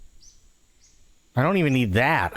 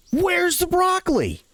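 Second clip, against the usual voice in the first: much higher, slow, indignant lady's shriek; angry demand. Retold or imitated. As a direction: drag it out, furious and shrill. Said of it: "he gets this really angry tap".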